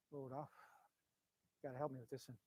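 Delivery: very soft, low plea to God; murmured prayer. Real, not acted. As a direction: breathe a quick prayer to the Lord.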